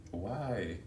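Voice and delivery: ordinary talk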